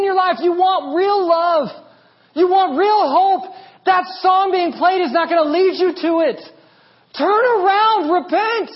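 high, loud prophetic shouting; John the Baptist screaming. Acted. Preacher as the Baptist calling people to repent, screaming. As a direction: cry out a warning to the crowd at full force. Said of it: "he'd be screaming it".

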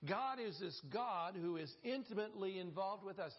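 ordinary talk, nothing marked

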